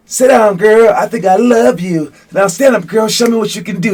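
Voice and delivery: hammed-up kid singer yelling at a woman. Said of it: "He's just, like, screaming at this".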